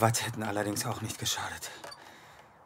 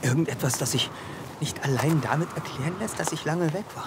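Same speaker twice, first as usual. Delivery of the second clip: agitated; 7 semitones higher, 4 dB louder than usual. Acted. Nerves breaking through on phone.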